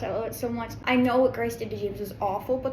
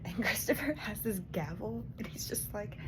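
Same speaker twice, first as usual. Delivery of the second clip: hushed unvoiced whisper, much softer; late-night whisper; 5 semitones lower, 7 dB quieter than usual. Real, not acted. Reader whispering book news at night, hushed whisper. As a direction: keep it secret, others asleep.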